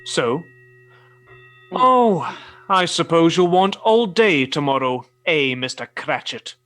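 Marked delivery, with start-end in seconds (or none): 1.7-5.5 loud, put-upon complaint; exasperated boss, voice raised; grudging exasperation. Acted. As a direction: grumble it out loudly at the clerk. Scrooge grudges clerk a holiday.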